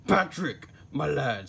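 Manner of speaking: an irritated boss snapping at his worker; snapped. Acted. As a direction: bite off the name in a temper. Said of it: "he snapped".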